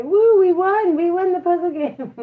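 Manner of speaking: high, excited cheer; celebratory cheer. Shown as a demonstration. Parent prompting child to cheer the win, celebratory. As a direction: whoop it up high for a child to copy.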